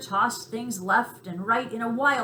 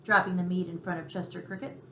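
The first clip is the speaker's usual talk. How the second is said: plain reading voice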